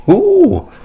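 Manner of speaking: very high loud squealing ooh; piercing excited ooh; delighted squeal. Real, not acted. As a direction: shriek with juicy delight. Reaction to the drama.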